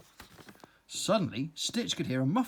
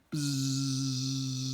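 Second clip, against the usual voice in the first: bee buzzing; 2 semitones lower than usual. Acted. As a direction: buzz like a bee. Bee buzzes around slug.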